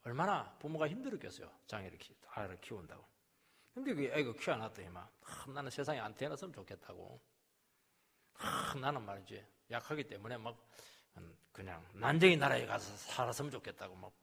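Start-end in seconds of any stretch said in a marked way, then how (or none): none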